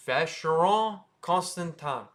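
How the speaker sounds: Vacheron Constantin as vash-er-on con-stan-tan; French SH and nasal ending in Vacheron Constantin; French pronunciation. Shown as a demonstration. Teacher introduces the brand, French pronunciation. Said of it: "That's not how you say it".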